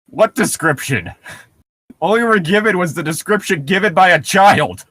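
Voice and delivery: ordinary talk, nothing marked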